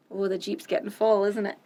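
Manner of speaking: plain reading voice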